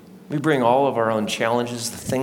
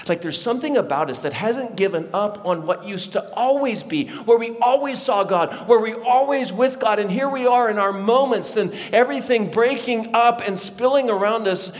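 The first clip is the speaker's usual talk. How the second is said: raised, high-pitched preacher's surge; impassioned preaching; 9 semitones higher than usual. Real, not acted. Pastor tells congregation what we lost in the garden, impassioned preaching. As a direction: build with urgent pulpit fervor.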